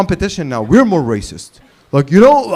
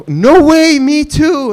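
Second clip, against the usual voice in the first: excited; 8 semitones higher, slower than usual. Retold or imitated. Excited Lebanese guy in dialogue.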